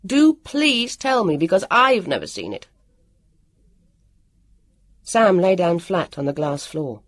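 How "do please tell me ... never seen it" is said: mildly sarcastic snap; a touch of angry sarcasm; snapped. A narrator's reading. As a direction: drag out the start in exasperation. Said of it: "he snapped".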